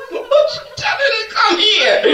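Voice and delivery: high laughing shout; laughing shocked question. Retold or imitated. Preacher retells shock when doctor calls her over, high laughing shout. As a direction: call it out in disbelief. Retold surprised reply to doctor.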